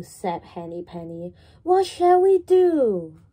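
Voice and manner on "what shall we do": high, loud worried cluck; alarmed hen. Acted. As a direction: gasp it like a fussing hen. Hen voiced in children's story.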